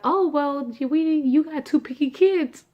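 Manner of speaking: perky high voice breaking the news; imitating the doctor. Ultrasound doctor telling her about twins, high and upbeat.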